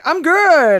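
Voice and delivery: high, loud mock shout; imitated revived man. Imitating the man who came back, high shout. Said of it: "then he was like".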